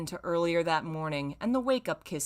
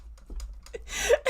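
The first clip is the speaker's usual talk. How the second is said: silent wheezing laugh; 3 dB quieter than usual. Real, not acted.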